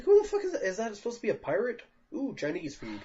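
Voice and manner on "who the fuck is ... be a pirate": incredulous. Retold or imitated. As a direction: shriek it in disbelief. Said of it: "I'm like".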